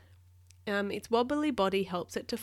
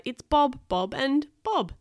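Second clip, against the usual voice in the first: high, loud, drawn-out names; raised storybook alarm; alarmed cry; 6 semitones higher, 7 dB louder, slower than usual. A narrator's reading. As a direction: cry out in dismay, stretching the names. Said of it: "he cried".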